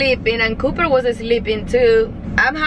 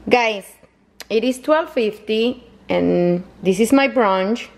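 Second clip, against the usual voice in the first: ordinary talk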